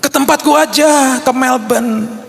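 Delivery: high-pitched, eager imitated friend; eager invitation. Friend offering the empty Melbourne apartment, imitated eager invitation.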